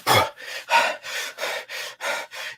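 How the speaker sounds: repeated forceful breaths out; heavy huffing sighs. Shown as a demonstration. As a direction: huff like a harried passenger. Acting out a stressed airline passenger.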